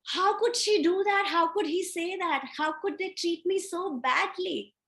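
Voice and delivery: high-pitched, mock-outraged protest; acted indignation. Acted outrage at being badly treated.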